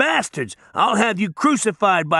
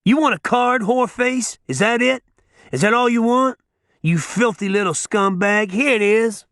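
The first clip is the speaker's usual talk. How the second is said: taunting snarl; 8 semitones higher than usual. A narrator's reading. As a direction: hurl the insults at him. Judge taunting the cringing man.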